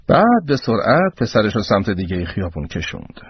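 usual speaking voice

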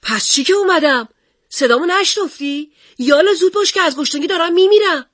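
put-on high, loud demanding boy; boy's shout. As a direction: yell it like a cranky hungry kid. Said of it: "داد زد".

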